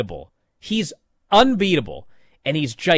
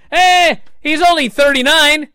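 high, loud mocking voice, slowing on he'll be fine; hammed-up chirpy reassurance; mock reassuring bloke; 12 semitones higher, 7 dB louder than usual. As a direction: parody a bloke brushing off worries, pitched way up.

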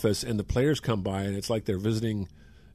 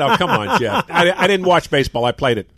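retold teasing; 5 semitones higher, 7 dB louder than usual. Retelling teasing a teammate.

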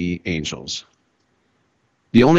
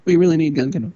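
mock earnest quote; 8 semitones higher, much faster than usual. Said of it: "if Colt Emerson was like".

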